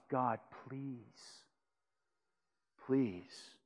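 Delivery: soft, low, hushed begging; quiet pleading sinner. Acted. Pastor voicing a sinner begging God, soft pleading.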